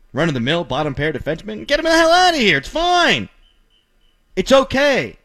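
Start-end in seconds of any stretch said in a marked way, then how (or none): 1.7-5.2 high, loud mock outrage; mock dismissal. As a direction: shoo him off in fake outrage. Mocking fans dumping a player.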